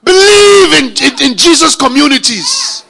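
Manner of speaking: loud, high, shouted preaching; hammered sermon cries, each Believe shouted; full-force preaching. Acted. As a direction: preach it out with fire.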